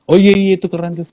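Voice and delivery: high, breezy fake praise; offhand praise. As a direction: brush it off with praise.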